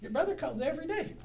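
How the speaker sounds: high, mock-nagging mother; guilt-trip like a complaining mom; mom's reproach. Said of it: "and I hear about it".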